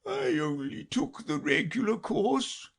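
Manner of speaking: high, slow, tearful turtle voice; sobbing Mock Turtle. Mock Turtle telling Alice his schooldays, sobbing. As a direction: weep it out like a sad old turtle.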